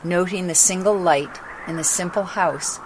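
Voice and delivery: ordinary delivery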